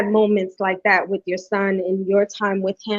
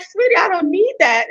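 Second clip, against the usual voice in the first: higher, a touch of a playful mom voice; teasing mom; 9 semitones higher than usual. Retold or imitated. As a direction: sweetly tease her son. Mom retells teasing son.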